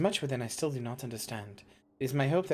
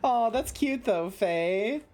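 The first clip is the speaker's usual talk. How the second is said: cooing; 9 semitones higher, 4 dB louder, much slower than usual. Real, not acted. Streamer coos at chat's sweet comment. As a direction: coo over something adorable.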